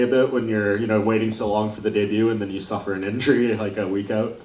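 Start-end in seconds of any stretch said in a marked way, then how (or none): none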